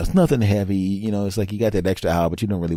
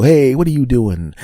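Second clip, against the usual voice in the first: loud, higher call; 8 semitones higher, 8 dB louder than usual. Retold or imitated. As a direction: shout it across the room, louder and higher. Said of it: "they're gonna go".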